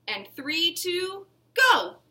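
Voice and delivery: race call. A narrator's reading. Host starting the race.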